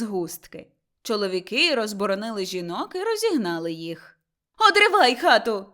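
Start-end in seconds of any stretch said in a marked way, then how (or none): 4.7-5.7 loud, fast angry shout; screeching demand; furious yelling. A narrator's reading. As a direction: rail at him fast and loud. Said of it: "репетувала Мотря".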